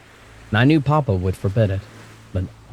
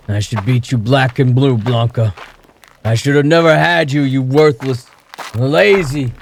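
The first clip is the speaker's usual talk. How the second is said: put-on louder, higher drunken rage; furious threat; 5 semitones higher, 5 dB louder than usual. A narrator's reading. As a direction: threaten her savagely. Father threatens daughter.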